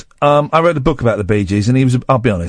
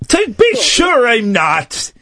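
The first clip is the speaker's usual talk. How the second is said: high, slow exaggerated Irish accent; mock Irish; 9 semitones higher, slower than usual. Host parodying the denial, hammed-up Irish accent. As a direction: deny it in a hammed-up Irish shout.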